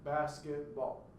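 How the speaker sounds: slow, "basketball" broken into its syllables; deliberate beat on each syllable of "Where is my basketball"; fluent but measured, syllable by syllable; counting syllables. Shown as a demonstration. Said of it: "All right, that is six syllables".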